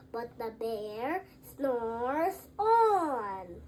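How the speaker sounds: drawn-out, slow and low; slow sleepy refrain. A narrator's reading. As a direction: let the line sink into sleep.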